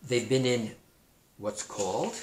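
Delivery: ordinary delivery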